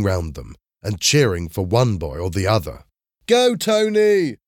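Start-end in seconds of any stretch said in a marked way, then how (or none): 3.3-4.4 high, drawn-out cheer; shouting. A narrator's reading. Boy in the crowd backs Tony in the fight, shouting. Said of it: "one of the boys shouted".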